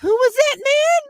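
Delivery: put-on high sweet toddler voice; little girl asking. Toddler in the back seat asking her dad, high and sweet. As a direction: ask it high like a three-year-old.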